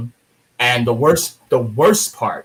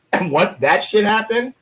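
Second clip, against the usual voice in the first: ordinary talk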